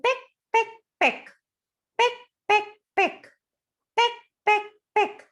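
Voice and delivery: high sharp peck peck; high bird pecks. Acted. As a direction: pipe it out like a tiny bird pecking. Imitating bird pecking.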